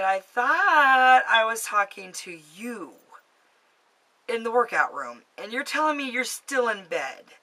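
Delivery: acted-out stunned realization; incredulous. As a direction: spell out what makes no sense. Retold disbelief at realizing.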